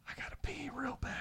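whispered, a little quick; hushed desperate confession; urgent whisper. Retold or imitated. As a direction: whisper it so the deer can't hear. Alone in a tree stand.

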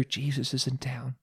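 soft hushed whisper into an ear. A narrator's reading. Martha tells Mary Jesus has come, hushed. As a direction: drop to a secret hush. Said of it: "she whispered in Mary's ear".

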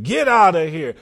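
loud, punched mock order; retold angry dismissal. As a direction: imitate someone throwing a person out. Retold brother throwing sister out.

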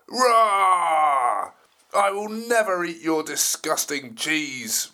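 loud roaring dinosaur voice; angry T-Rex roar. Acted. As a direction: bellow like a furious T-Rex. T-Rex refuses.

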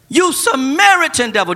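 high, loud insult; shouted slur. Acted. Crowd shouting at Jesus, loud. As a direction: fling it hard. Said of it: "The people retorted".

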